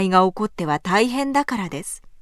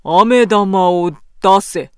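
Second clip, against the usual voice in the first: low, slow commanding samurai; stern samurai; 3 semitones lower, 6 dB louder, slower than usual. A narrator's reading. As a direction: growl the order gravely. Samurai demands candy.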